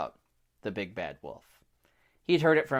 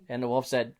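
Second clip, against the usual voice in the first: ordinary delivery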